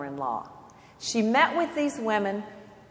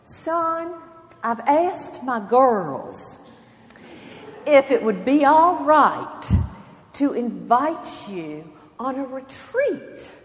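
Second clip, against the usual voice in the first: slow, high Southern grandmother voice; gracious older Southern lady, unhurried; Southern accent; 5 semitones higher, 3 dB louder, slower than usual. Retold or imitated. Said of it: "in her beautiful Southern accent".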